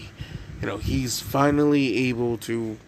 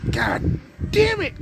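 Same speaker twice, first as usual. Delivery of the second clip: much higher, slow, strained self-curse; embarrassed groan; slower than usual. Acted. Alone after Izuku walks inside, Mitsuki curses herself in a high slow squeak. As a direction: squeak it out at yourself, mortified.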